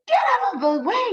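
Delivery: high, fast shouted warning; yelling. Acted. Sheep shouting at the duck from the jeep, high and fast. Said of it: "he yells with a beep".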